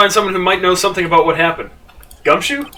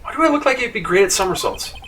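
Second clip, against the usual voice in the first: ordinary talk, nothing marked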